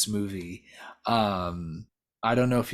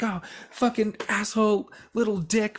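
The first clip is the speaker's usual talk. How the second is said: much higher, louder mock scream of insults; imitating furious screaming; 11 semitones higher, 5 dB louder than usual. The bride curses the driver who peels off, in a mock scream. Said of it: "just screaming".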